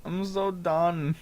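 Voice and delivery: ordinary talk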